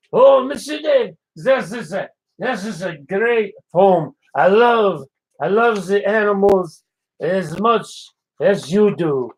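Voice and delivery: put-on French chef accent. French chef greeting Dave on the morning show, in a French accent. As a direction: play the cheerful French chef, accent laid on thick.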